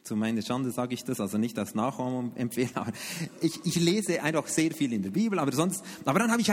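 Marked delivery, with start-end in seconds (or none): none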